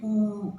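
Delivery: "ऊ" held long; teacher demonstrating long "ऊ"; long sound. Shown as a demonstration. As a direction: stretch "ऊ" into a sustained "oo". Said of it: "इसकी sound बन जाएगी".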